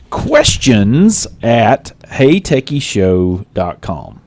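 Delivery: slow, careful spelling out; slow dictation. Shown as a demonstration. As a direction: spell the address slowly. Reading out the contact email.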